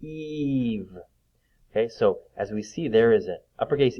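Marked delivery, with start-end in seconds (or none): 0.0-0.9 "Eve" with a long held ee. Shown as a demonstration. Teacher asking pupils to hear it, drawn-out "Eve". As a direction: stretch the vowel of "Eve" so it can be heard. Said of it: "It's the E sound".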